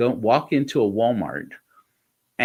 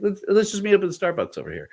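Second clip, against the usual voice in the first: higher, fast, carefree put-on voice; mock clueless self; 9 semitones higher, faster than usual. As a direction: play yourself as oblivious. Mocking his own careless offer.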